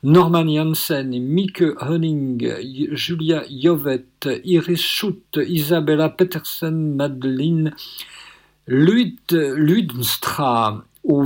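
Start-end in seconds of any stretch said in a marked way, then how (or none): none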